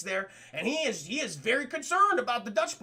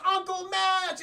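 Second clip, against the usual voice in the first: put-on little girl voice; 9 semitones higher, slower than usual. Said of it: "She goes".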